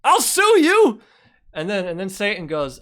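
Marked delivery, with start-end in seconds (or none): 0.0-1.0 high, loud mock outrage; outraged God. God yelling at Satan over the engineer, high and loud. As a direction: shriek it like a furious God threatening to sue. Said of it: "And God's like".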